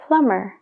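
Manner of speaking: "plumber" said as plummer; no b sound in "plumber"; silent b in plumber. Shown as a demonstration. Teacher models "plumber" with a silent b. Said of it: "esa B es como si no existiera".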